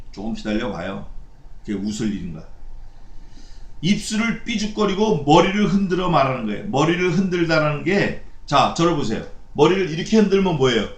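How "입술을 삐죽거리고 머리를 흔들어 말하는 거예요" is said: normal talking voice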